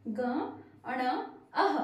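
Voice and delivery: syllable by syllable with pauses; read along slowly. Shown as a demonstration. Teacher leading children to read syllables together. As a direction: break it into ग, अण, अह for the kids. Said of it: "पढ़िए मेरे साथ".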